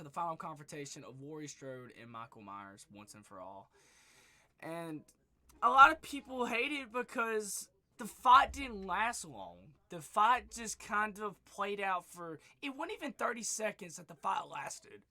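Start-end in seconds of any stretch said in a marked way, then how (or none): 4.6-15.0 strained, hoarse voice; losing voice. Real, not acted. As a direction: voice giving out. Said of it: "I'm losing my voice".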